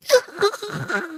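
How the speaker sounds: a short high burst of laughter; high giggle. Real, not acted. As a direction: giggle at your own silliness. Laughing at her own joke.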